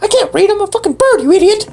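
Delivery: high fast bird voice; squeaky bird voice. Acted. Bird PD refuses help, high fast squeaky voice. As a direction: chirp it out fast.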